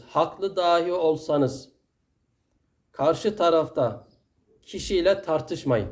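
very slow, every word weighed; "Haklı dahi olsanız" drawn out slowly, each word set apart; slow solemn quote. Retold or imitated. Preacher quoting the Prophet to the congregation, slow and solemn. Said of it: "Hatta diyor ki Peygamberimiz".

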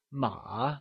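"ma" softly dipped, then rising; falling-rising tone. Shown as a demonstration. Teacher gives the correct answer, "ma" in falling-rising tone. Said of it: "falling rising tone".

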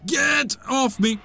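high, drawn-out protest; slow, high, exasperated yell; frustrated yell. Real, not acted. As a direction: shout at an attacker to let go. Yelling at game enemy.

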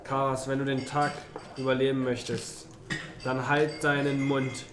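ordinary talk

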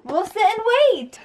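high, bright make-believe voice; pretend play voice. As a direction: play a rescue game with a toddler.

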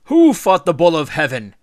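triumphant rallying shout. A narrator's reading. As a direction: bellow the question as a victor. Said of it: "he shouted".

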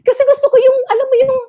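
high bursts of laughter through the words; laughing. Real, not acted. Laughing at her own childhood dream of being a labandera.

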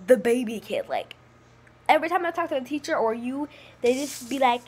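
ordinary delivery

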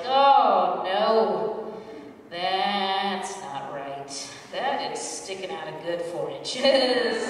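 horrified shock. Retold or imitated. New mother in the bathroom, shocked and slowly dismayed. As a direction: stare in disbelief and drag it out. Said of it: "I'm just like".

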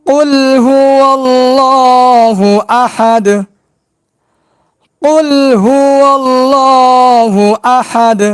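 tajweed recitation; Quranic recitation. Shown as a demonstration. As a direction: recite it drawn out and melodic. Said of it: "এবার শুরু থেকে আসি".

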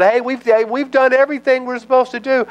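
high, wheedling excuse; eager to please. Retold or imitated. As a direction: sell it with a high fawning voice. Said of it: "he's buttering him up".

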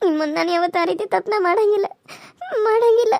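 tearful, sobbing self-defence; acted crying. Pleading with Nitya that the mistake won't be repeated, crying. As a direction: plead through tears, voice catching. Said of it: "ನಾನು ಏನಂತ ಹೇಳಿ ಅಳಾಕತ್ತಿದ್ದಿ".

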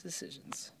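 soft unvoiced whisper to yourself. Real, not acted. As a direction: breathe the word like a private thought. Musing over a polo shirt.

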